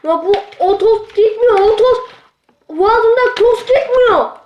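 panicked whine about the dust in the throat; distressed complaint. Real, not acted.

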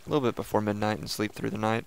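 ordinary delivery

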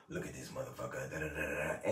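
a hint of a mean mocker; mock malicious jab. Showing the cruel joke he never makes, a low soft sneer. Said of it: "in a malicious way".

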